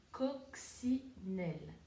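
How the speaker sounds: coccinelle said slowly, syllable by syllable; slow syllable count. Shown as a demonstration. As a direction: spell out coccinelle in beats. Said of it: "taper les syllabes".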